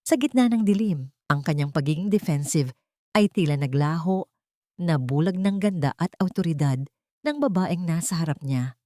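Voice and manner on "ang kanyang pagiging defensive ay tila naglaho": usual speaking voice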